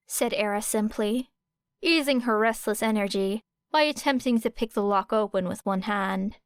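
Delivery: usual speaking voice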